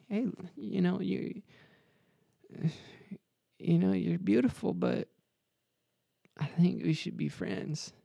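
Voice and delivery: high, soft mock crooner letting her down; a lightly voiced smooth letdown. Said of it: "He said".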